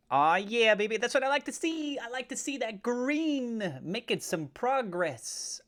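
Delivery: high, drawn-out whoop of delight; gleeful cheering. Real, not acted. Trader cheering green candles to his viewers, gleeful.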